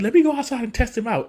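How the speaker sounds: high, bouncy cartoon hero voice; imitating Sonic. Sonic hyped about his new gear, cocky high voice. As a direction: pitch up as the cocky hedgehog. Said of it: "he's like".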